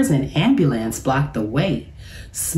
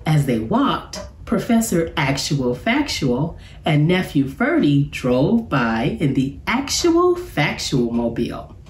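usual speaking voice

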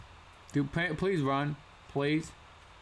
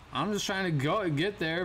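normal talking voice

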